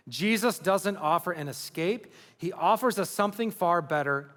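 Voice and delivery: ordinary talk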